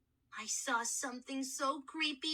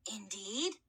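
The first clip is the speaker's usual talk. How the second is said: hoarse mummy voice; 5 semitones lower than usual. Acted. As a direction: croak it curiously. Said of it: "a very hoarse voice".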